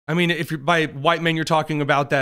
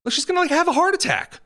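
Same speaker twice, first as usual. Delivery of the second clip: much higher, fast mock panic; mock alarm; 12 semitones higher, faster than usual. Mocking the woman's terror for viewers, squealing high and fast. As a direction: squeal it like a hammed-up fright.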